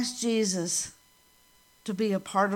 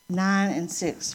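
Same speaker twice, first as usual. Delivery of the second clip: usual speaking voice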